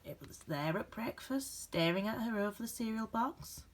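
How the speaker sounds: gentle, expressive storybook reading with dramatic pauses; reading to children. A narrator's reading. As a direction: read it like a bedtime picture book for a class. Reads picture book aloud.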